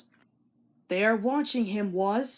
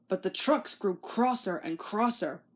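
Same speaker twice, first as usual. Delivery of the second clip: usual speaking voice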